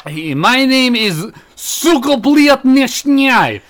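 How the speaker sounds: slow, high hammed-up Russian accent; mock Russian accent. Introducing himself to the audience, slow hammed-up Russian accent. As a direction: drawl out a fake Russian name in a Russian accent. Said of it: "How are you gonna be Russian".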